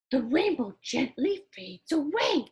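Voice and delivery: ordinary delivery, nothing marked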